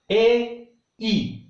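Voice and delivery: normal talking voice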